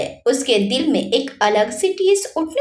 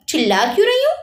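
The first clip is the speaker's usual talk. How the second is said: irritated snap; 7 semitones higher, faster than usual. Acted. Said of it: "Virat चिढ़ते हुए बोला".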